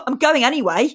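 retold passive-aggressive jab. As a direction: insist huffily, acting out the retort. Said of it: "really passive aggressive with him".

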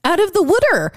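high, playful Philly "wooder"; Philadelphia accent. Pretend. Echoing co-host's pun on water, mock Philadelphia accent. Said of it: "they say things like "wooder".

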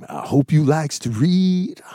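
drawn-out, high, loud introduction; Mister Bookworm character. Acted. Performer introducing himself as Mister Bookworm, hammed-up character voice. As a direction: play a big storybook host greeting the audience.

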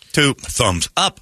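two thumbs up, word by word with odd gaps; slow, disjointed playback imitation; stilted computer pacing. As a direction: imitate a pieced-together computer voice. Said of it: "the pacing and the".

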